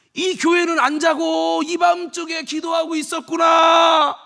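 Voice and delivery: fervent preacher's cry; impassioned preaching. Real, not acted. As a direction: swell into the climax. Preaching climax over music.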